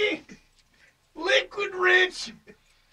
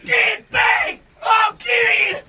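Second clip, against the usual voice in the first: loud, higher shouted threats; drunken threat; 6 semitones higher, 5 dB louder than usual. Acted. Red yelling at phantom attackers in a drunken rage. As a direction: swing at shadows while you yell.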